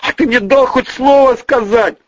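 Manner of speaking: high-pitched indignant retort. Acted. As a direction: raise your voice back at him. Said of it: "повысил голос Бонифацкий".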